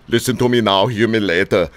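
a light imitation of an Austrian bodybuilder; tossing off a quick muscleman catchphrase; Arnold Schwarzenegger. Host riffing on Martin's Schwarzenegger tweak, light mock muscleman voice. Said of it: "tweak it to sound like Arnold Schwarzenegger".

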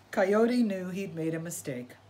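ordinary delivery

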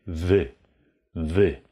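wy with a dark Polish y, not wi; isolated syllable wy. Shown as a demonstration. Teacher isolates the tricky syllable for learners. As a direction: hold just the syllable wy. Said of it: "Kein Wi".

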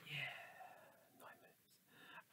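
breathy hushed whisper, slow; whispered aside. Real, not acted. Checking the clock mid-reading, whispered. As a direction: whisper the time under your breath.

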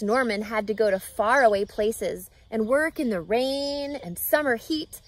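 usual speaking voice

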